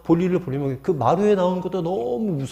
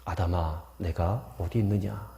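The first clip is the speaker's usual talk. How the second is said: lower, softer, slow tender call; gentle calling; 7 semitones lower, 6 dB quieter than usual. Pretend. Preacher voicing God gently calling hiding Adam, tender and soft. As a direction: call him back as a loving father would. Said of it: "너무나도 부드러운 음성으로".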